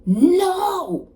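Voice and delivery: high, loud drawn-out cry of defiance; yelled no. Retold or imitated. Hurt fighter refusing to stay down, yelling out. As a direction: yell it out like a movie hero's big moment. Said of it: "And he yells out".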